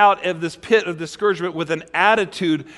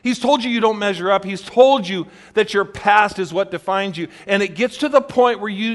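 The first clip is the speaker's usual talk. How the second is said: usual speaking voice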